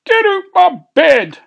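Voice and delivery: very high, loud dwarf shriek; petulant high-pitched outburst; outraged yell. Acted. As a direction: yell at the intruder to shift. Greety orders sleeper out of bed.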